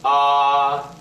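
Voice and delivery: long flat "ah"; first tone. Shown as a demonstration. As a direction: sustain "ah" on one steady note. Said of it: "the first tone".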